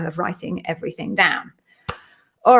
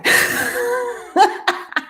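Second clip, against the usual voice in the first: high giggle. Real, not acted. Laughing at her own joke.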